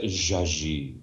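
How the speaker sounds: low, mock French accent. Shown as a demonstration. Narrator savouring a writer's name, French accent. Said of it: "say it with a French accent".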